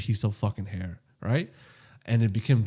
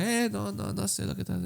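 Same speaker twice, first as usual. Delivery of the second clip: high, softer dismissive voice; cheap barber; 9 semitones higher, 9 dB quieter than usual. Pretend. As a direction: play a barber turning the job down, pitched up. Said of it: "if I went to a twenty dollar barber".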